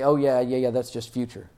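lightly imitated brush-off; mock dismissive. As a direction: shrug it off as a scoffer would. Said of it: "Don't read it and say".

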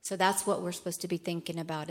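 ordinary talk

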